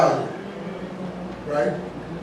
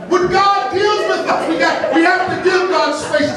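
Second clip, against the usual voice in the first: preaching shout; 5 semitones higher than usual. Real, not acted.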